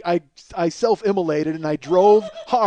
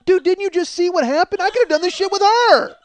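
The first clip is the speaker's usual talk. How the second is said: high, loud incredulous outburst; exasperated rant; 13 semitones higher, 6 dB louder than usual. Retold or imitated. Husband berating helper over fallen drawers, exasperated. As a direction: berate the helper at full pitch.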